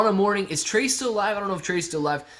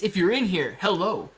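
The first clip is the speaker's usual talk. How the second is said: usual speaking voice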